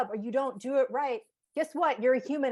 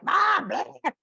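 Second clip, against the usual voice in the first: put-on higher, whiny child's brush-off; kid voice; 5 semitones higher, 6 dB louder than usual. Mother mimicking her kid's bratty dismissal, whiny. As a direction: mimic a kid blowing mom off with a raspberry. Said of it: "this example of the kid being like".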